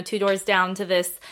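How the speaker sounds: normal talking voice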